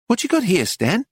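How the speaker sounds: high, brisk shopkeeper; high bossy question. A narrator's reading. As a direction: call him out brightly. Missus Lacy asking Stan.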